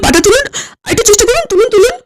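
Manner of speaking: fast, high urging; urgent coaxing. Acted. Man helping the fallen guest up, fast urgent coaxing. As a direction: hurry him up, coaxing each lift.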